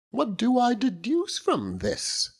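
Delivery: high, earnest matronly lady voice; high-pitched loyal wife holding forth; Mrs Micawber. Acted. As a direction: lift into the proud, insistent wife.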